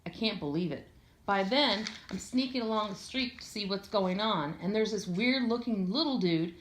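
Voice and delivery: ordinary delivery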